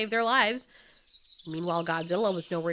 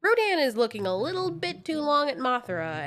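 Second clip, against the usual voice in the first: plain reading voice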